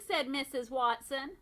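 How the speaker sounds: ordinary delivery, nothing marked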